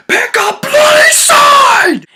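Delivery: high, slow, yelled demand; furious shout. Retold or imitated. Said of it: "Crowley's like".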